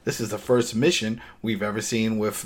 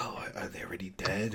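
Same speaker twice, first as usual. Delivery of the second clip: whispered, breathy question; unvoiced hushed whisper; hushed wondering; 3 semitones lower, 12 dB quieter than usual. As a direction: whisper it like you're leaning over a body. Characters wondering over body bags.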